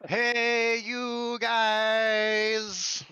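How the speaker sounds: lumbering giant calling out hello; Sloth voice. Retold or imitated. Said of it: "give us a good sloth impression from the Goonies".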